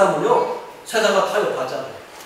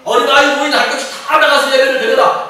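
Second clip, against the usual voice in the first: loud, high, commanding ruler's voice; Pharaoh; 8 semitones higher, 6 dB louder than usual. Acted. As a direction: proclaim it as a king giving orders, punching each group. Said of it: "바로가 뭐라고 그래요?". (왕이 명령하듯 높고 크게 외치며)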